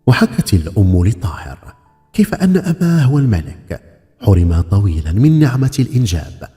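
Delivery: ordinary delivery, nothing marked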